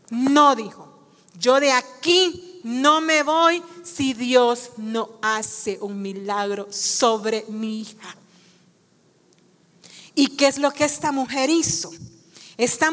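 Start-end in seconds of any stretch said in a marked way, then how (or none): 1.3-8.2 very slow, weighty vow; slow, solemn declaration falling lower at the end; emphatic resolve. Acted. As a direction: stand your ground, spacing out each phrase. Woman's refusal repeated with emphasis.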